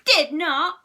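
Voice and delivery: high, loud denial; childish protesting yell; indignant shriek. A narrator's reading. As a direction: shriek back in a squabble. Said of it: "shrieked Susan".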